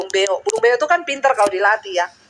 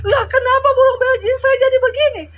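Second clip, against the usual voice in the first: dismayed pastor; 11 semitones higher than usual. Retold or imitated. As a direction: cry out in shocked disbelief, half laughing. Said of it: "Pak Pendeta bilang".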